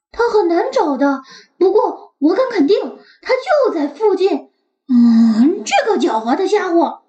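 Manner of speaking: quick eager little dog; eager puppy. Acted. Max explains. (急切的小狗)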